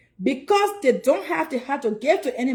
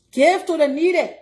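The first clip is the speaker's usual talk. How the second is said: louder, preaching the line; loud exhortation; 4 semitones higher, 7 dB louder than usual. Real, not acted. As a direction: call it out like a sermon point. Preaching about giving to charity.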